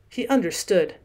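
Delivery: plain reading voice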